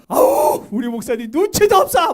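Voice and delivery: high, loud exasperated outburst; hammed-up annoyance at a tactless pastor; mock indignation. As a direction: play an offended churchgoer grumbling loudly. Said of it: "기분 나쁘죠?".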